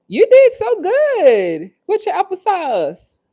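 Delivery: drawn-out high-pitched praise. Real, not acted. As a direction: coo proudly to a little one. Praising her baby mid-stream.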